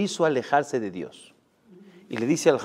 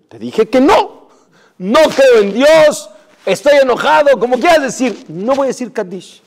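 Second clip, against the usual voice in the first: angry refusal; 8 semitones higher, 9 dB louder than usual. Acted. Angry man refusing Kaddish.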